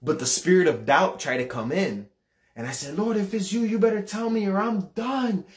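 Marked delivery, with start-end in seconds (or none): 3.0-5.5 high, wailing demand, acted; desperate ultimatum. Doubting teenager challenging the Lord, desperate. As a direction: plead and threaten with pitch raised.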